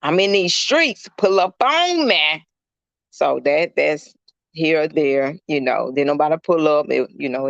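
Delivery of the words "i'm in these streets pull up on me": high, slow taunting quote; mock her street swagger, drawn out; imitating the goat. Retelling the goat's invite, higher and slower taunt. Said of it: "she's like".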